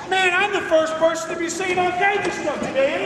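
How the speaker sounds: ordinary delivery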